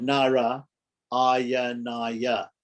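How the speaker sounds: Nara, a pause, then Ayanaya; word cut in two. Shown as a demonstration. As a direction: set Nara apart from Ayanaya with a clear gap. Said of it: "cut Narayanaya into two".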